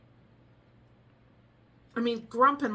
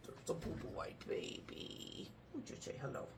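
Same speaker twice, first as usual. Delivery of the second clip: whisper to pet; 6 semitones lower, 16 dB quieter than usual. Real, not acted.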